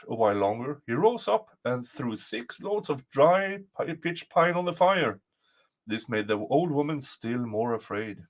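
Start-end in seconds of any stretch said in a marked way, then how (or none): none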